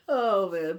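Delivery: normal talking voice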